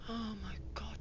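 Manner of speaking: breathy whispered aside. Real, not acted. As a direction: whisper it in disbelief. Whispered reaction.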